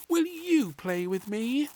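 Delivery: put-on high, slow child's plea; high, slow little girl; little princess voice. A narrator's reading. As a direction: ask sweetly like a little girl.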